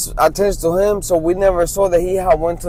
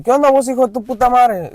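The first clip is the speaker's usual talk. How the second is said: light, fast, friendly cursing greeting; casual Mexican greeting; 7 semitones higher, faster than usual. Retold or imitated. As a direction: toss it off like a casual hello between friends. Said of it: "it's like".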